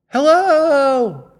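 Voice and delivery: high, long called hello; echoing call. Pretend. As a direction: call out across a valley. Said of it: "This noise".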